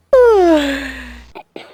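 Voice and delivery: a long, loud tired sigh; weary sigh. Acted. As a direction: let out a big exhausted sigh. Tired before the big day.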